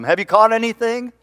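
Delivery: high, loud call across the water; calling from shore. Acted. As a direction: shout it out to the boat from the shore. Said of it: "who's yelling out at him".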